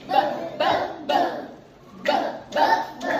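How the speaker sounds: "B" as a short "buh", not the letter name; letter-sound B. Shown as a demonstration. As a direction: pop each "B" out as a brief voiced burst. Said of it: "Qual é o som da letra B".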